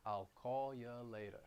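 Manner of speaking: New York, you reduced to ya, slow. Shown as a demonstration. Teacher demoing reduced ya for learners. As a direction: say call ya later, New York style. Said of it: "I'm reducing you to ya".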